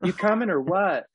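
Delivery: put-on girl's friends. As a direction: call her over like a bubbly friend in a car.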